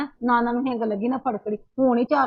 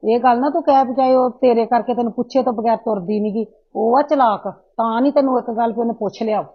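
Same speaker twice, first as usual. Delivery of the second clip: angry scolding; 7 dB louder than usual. Acted. Mother's quarrel continues.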